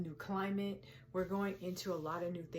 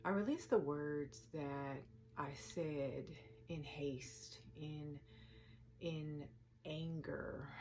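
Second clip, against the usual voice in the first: soft affirmations with long pauses; quiet, unhurried meditation voice; guided meditation; 8 dB quieter than usual. Acted. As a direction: speak each release gently, then let it settle. Leads a release meditation.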